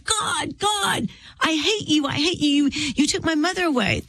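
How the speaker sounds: high-pitched, held-back retold outcry; angry twelve-year-old. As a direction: imitate an angry child crying out to God. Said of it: "take our angry fists at twelve".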